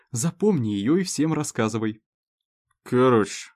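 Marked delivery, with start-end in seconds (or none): 2.8-3.5 put-on town drunk. Drunk owl explains.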